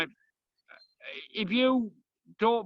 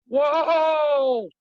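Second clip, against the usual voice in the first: loud, high startled shout; startled whoa; 6 semitones higher, 8 dB louder than usual. Real, not acted. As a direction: burst out in sudden surprise. Startled by a bowling shot.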